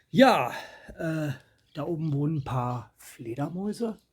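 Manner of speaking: usual speaking voice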